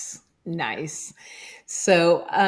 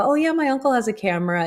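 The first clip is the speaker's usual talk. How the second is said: dismissive; 7 semitones higher, faster than usual. Retold or imitated. People dismissing his job.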